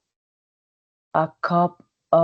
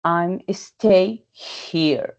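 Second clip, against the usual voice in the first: "I'm stay here" drawn out at half speed; very slow example; 3 semitones higher, much slower than usual. Shown as a demonstration. Teacher modelling the diphthong in a slow sentence. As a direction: stretch the sentence for learners.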